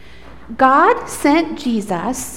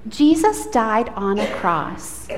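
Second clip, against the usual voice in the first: usual speaking voice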